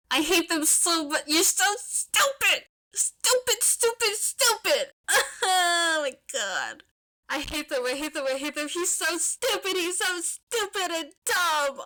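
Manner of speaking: high-pitched whiny wail of hate; fond exasperation. Real, not acted. Fan reader squealing at the couple's obliviousness, high and whiny. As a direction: squeal it like you love them too much.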